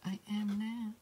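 very high-pitched, silly squeaky voice; pinky voice. Pretend. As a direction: put on a playful, high little pink voice. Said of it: "That's my pinky voice".

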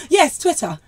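laughing through the words. Real, not acted. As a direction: break into a laugh while saying it. Laughing at his pronunciation.

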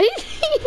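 sudden high giggle; high burst of laughter. Real, not acted. Teammate blew himself up.

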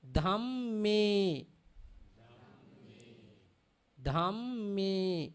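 "dhamme" with a held double m and a clear final e; word-final vowel. Shown as a demonstration. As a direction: model "dhamme" carefully. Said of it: "At end of the word".

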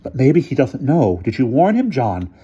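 high, worried questioning; fretting to her husband; anxious. A narrator's reading.

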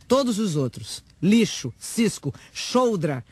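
declaimed tirade of disgust; scornful outburst. Acted. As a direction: hurl each insult separately with contempt. Hurling insults in declaimed manifesto.

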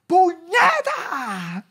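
high, loud, drawn-out mock scream; imitating wife's screaming. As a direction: scream it like a wife losing it. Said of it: "empieza a gritar". (imitando los gritos de su esposa)

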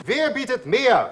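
ordinary talk, nothing marked

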